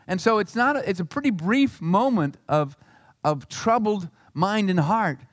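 ordinary delivery

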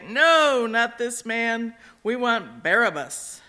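loud defiant shout from the crowd; crowd shouting back. A narrator's reading. The crowd shouts back to Pilate, loud and defiant. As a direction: yell it back, refusing him. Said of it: "They shouted back".

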